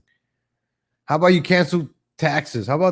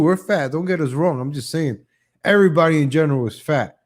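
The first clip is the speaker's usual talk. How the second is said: ordinary talk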